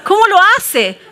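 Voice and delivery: high, loud incredulous question, amused; acted bafflement. Onlooker wonders at ugly man's success, mock bafflement.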